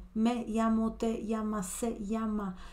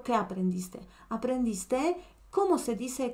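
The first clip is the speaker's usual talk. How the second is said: ordinary talk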